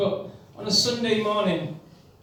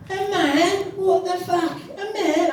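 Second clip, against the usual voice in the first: much higher, louder shouting inner voice; [name] shouting; 12 semitones higher, 5 dB louder than usual. Acted. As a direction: bark at yourself like a London lad.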